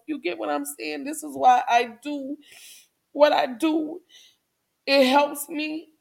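ordinary talk

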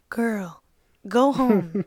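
firm mock warning to yourself; little sober voice. Her sober voice warns her drunk self, firm. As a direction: imitate an inner voice of reason. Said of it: "a little sober voice that's like".

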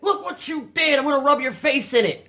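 high shouted scolding; mock screaming. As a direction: scream at the spider, over the top. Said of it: "a person screaming at a spider".

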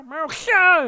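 a loud, high mock cat meow. Streamer meowing loudly back at his cat.

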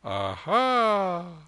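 high, loud, drawn-out aha; smiling lama. Acted. Lama dangling the rosary before the child, smiling and playful. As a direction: tease it out playfully with a smile.

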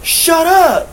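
high, sharp yell. Retold or imitated. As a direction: bark it at the ghost. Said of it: "And I said".

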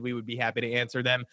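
ordinary talk

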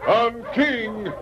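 high, drawn-out call urging the huskies on; calling to dogs. Acted. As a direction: shout the team forward over the wind, slowly. Mountie driving dog team in storm.

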